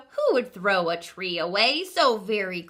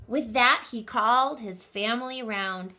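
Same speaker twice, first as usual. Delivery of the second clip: ordinary delivery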